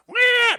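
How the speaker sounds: one loud, piercing mock cry; imitated baby wail. Baby interrupts the sleepless parent's prayer, mock wailing. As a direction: cut in with a baby's squeal.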